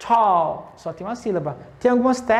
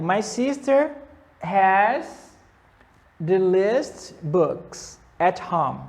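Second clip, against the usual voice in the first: slow model sentence; much slower than usual. Shown as a demonstration. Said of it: "teacher models the sentence".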